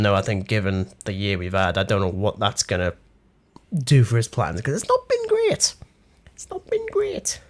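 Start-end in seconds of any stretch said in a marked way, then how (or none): 4.6-5.7 squeaky high-pitched aside. Pretend. Ironic aside, high far voice. 6.3-7.4 breathy high whisper. Shown as a demonstration. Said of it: "repeat whispered".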